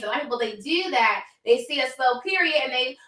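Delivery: ordinary talk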